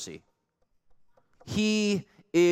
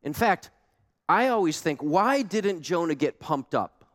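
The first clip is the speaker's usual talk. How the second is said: ordinary talk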